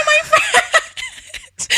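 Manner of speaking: high squeal through real laughter; helpless laughter. Real, not acted. Telling co-host about a friend, helplessly laughing. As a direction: struggle to get the words out, laughing. Breaks up laughing mid-story.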